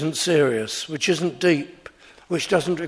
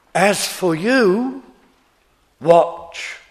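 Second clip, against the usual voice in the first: slow, rising "you", pause, then a loud short "watch"; emphatic you; 4 dB louder, much slower than usual. Shown as a demonstration. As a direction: lift and stretch "you" before a punched "watch". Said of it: "to get the force of the Greek".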